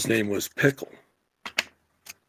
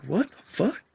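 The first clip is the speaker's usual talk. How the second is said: shocked coworkers; 11 semitones higher than usual. Retold or imitated. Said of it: "Everybody's like".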